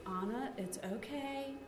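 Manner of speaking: put-on soft, slow reassurance to a fussy toddler; softly soothing a crying toddler; calm soothing mom. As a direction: hush it gently, like a patient mother.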